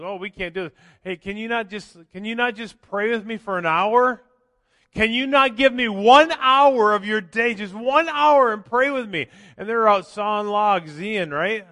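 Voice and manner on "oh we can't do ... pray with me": rising, louder, pleading for one hour; pleading Jesus. Retold or imitated. Jesus pleading with sleepy apostles, loud and rising. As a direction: beg the sleeping apostles to stay awake. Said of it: "he goes".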